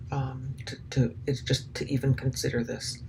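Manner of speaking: whisper. Real, not acted. Sickened aside about the abuse.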